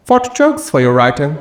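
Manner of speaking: high, mocking interrogator; judging voice. The judging thing quizzes the comedian, high and mocking. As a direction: quiz him snidely. Object voice keeps questioning him.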